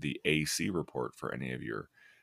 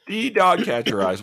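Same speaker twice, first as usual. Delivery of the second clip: higher and louder, proclaiming it; proud catchphrase shout; 12 dB louder than usual. Real, not acted. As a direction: announce it like a slogan. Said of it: "did you just make yourself a new catchphrase?".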